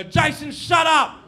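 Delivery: high, loud telling-off; exasperated teacher shout. Acted. Teacher silencing the boy at the back, high angry shout. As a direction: cut him down at full volume. Teacher cuts off disruptive pupil.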